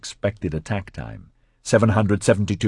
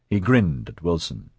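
ordinary delivery

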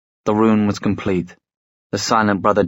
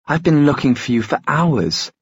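with asperity; 6 semitones higher than usual. A narrator's reading. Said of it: "she said with some asperity".

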